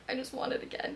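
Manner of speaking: breathy whispered repeat under her breath; soft whispered echo of the wish. Shown as a demonstration. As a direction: whisper it like a secret longing.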